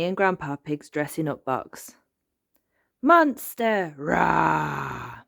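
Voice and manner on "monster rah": playful monster roar; George. A narrator's reading. Little boy in monster costume, shouting playfully. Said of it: "shouted George".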